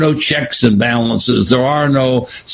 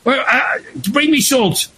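high, demanding cartoon king calling out; high-pitched grumpy king in a comic strip; 10 semitones higher than usual. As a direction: whine for Schultz like a spoiled cartoon king. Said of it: "He says".

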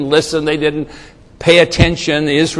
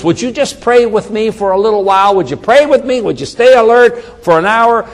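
higher-pitched, imploring questions; pleading request; 7 semitones higher than usual. Retold or imitated. Jesus asks his disciples to pray with him, pleading. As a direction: voice an earnest plea, pitched up.

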